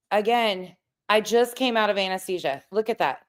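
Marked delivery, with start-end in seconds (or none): none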